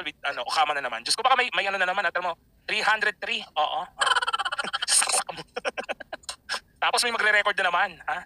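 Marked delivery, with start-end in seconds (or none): 3.8-6.6 laughing. Real, not acted. Laughing during the chat.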